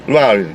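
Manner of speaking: mumbled mock name, barely a word; slurred name. Speaker mocks how job seekers slur their names. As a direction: swallow the name into one slurred syllable. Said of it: "they say something like".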